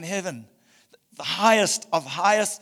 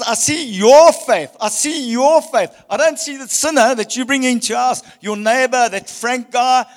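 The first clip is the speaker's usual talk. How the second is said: full-force preaching; 8 semitones higher, 10 dB louder than usual. Acted. Pastor to his congregation about faith, loud fervent preaching. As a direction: proclaim it across the whole church.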